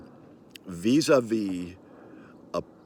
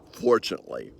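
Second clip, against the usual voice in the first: ordinary talk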